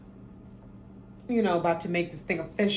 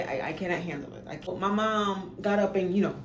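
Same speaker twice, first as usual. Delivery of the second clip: ordinary talk, nothing marked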